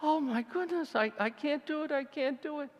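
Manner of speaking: high, frightened wail of refusal; retold panic. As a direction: beg off the drop in a mock panic. Retelling his fear at the ledge.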